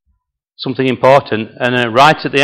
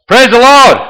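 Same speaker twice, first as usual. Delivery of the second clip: very high, loud shout; 12 semitones higher, 8 dB louder than usual. Acted. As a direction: shout it out to urge the donkey on. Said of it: "And he shouts".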